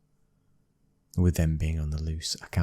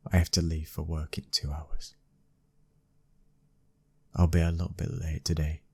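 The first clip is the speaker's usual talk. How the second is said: ordinary talk, nothing marked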